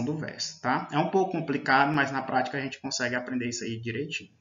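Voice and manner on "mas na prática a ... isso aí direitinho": ordinary talk, nothing marked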